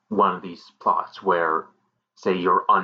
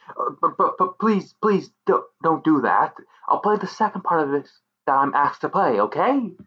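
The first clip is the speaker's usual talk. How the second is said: scared stammer; 6 semitones higher than usual. Acted. Extra begging, scared.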